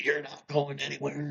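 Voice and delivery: high, softened mock order; lightly voiced mother forbidding her daughter to leave; imitating insistent mother. Retold mother-daughter tug-of-war over pocketbook.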